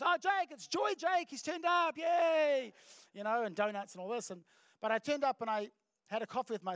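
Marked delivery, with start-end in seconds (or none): 0.0-2.7 high, loud, drawn-out hammed-up welcome; mock delighted cheer. Imitating co-workers thrilled that he turned up, gleeful cheer. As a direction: squeal a slow, gleeful greeting. Workplace greets his arrival with cheers.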